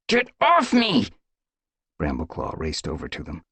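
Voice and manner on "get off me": high, loud, slow furious line; furious hiss. Acted. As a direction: throw her off in fury. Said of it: "hissing with fury".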